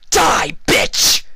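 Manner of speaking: very high, very loud murderous scream; furious shout. A narrator's reading. Vinyl attacking Trixie on the floor, murderous scream. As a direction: scream it as you swing the crowbar. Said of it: "Vinyl shouted".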